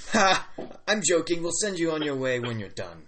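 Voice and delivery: badly faked Australian, jovially booming at first; attempted Australian accent. Chief's son admitting his joke, attempted Australian accent. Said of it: "This is not an Australian accent".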